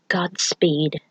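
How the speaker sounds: breathy, voiceless whispered farewell. A narrator's reading. Queen whispers farewell to the Thunder God over cliffs. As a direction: let it fall away into a whisper. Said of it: "whispered over the steep cliffs".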